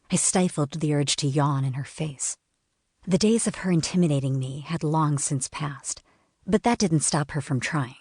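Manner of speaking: ordinary delivery, nothing marked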